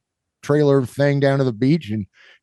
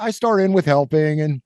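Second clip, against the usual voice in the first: ordinary delivery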